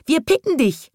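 very high, loud bird voices; zwitschernd. A narrator's reading. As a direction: chirp the threat like little birds. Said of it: "zwitscherten die Vögel". (hoch und laut zwitschernd)

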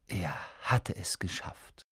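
hushed, breathless whisper of relief. A narrator's reading. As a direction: breathe it out in awe. Quiet relief after crossing.

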